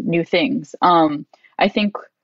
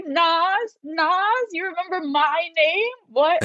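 high, airy fangirl gasp; starstruck disbelief; 11 semitones higher, much slower than usual. Retold or imitated. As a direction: gush in stunned delight. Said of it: "I was like".

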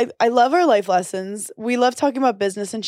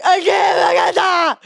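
loud, high, drawn-out yell; retold frustrated outburst; 8 semitones higher, 5 dB louder, slower than usual. One-handed woman failing to open something, mock frustrated yell. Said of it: "I'd just be like".

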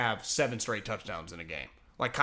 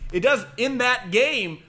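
slow, louder, higher and punched; emphatic rebuttal; 9 semitones higher, 6 dB louder, slower than usual. Real, not acted. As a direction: hammer home each word of It does in that game. Rebutting the momentum argument.

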